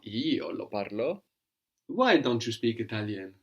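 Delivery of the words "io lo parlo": Io lifted with a rising push; emphatic answer: "Io" stressed against the question. Shown as a demonstration. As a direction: lean on "Io" to contrast, then let "lo parlo" fall away. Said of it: "so you are emphasizing".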